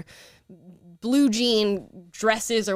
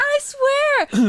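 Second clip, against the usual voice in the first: high squeal; 7 dB louder than usual. Acted. Playful mock insistence.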